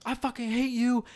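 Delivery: high-pitched retold scream, held down in volume; mock screaming. Retelling the woman next door, mock screaming. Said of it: "the woman was basically screaming".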